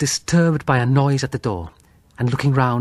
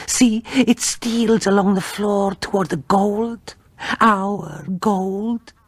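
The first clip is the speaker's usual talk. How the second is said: enchanted by the gold, voice drifting; eerie feverish wife; 7 semitones higher than usual. Acted.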